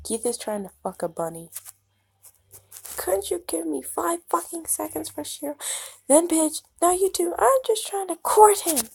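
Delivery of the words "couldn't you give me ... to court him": much higher, exasperated protest; irritated high yell. Acted. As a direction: shoo them away indignantly. Keith snaps at interrupting friends.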